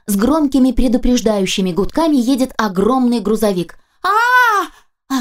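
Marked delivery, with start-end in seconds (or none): 4.0-5.2 terrified scream. Acted. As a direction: scream for your life as the truck bears down. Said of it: "ору я".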